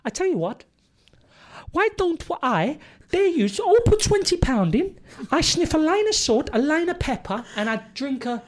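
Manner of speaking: put-on high Welsh accent throughout; sing-song Welsh impression, very high; Michael Flynn. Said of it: "this is how he used to talk".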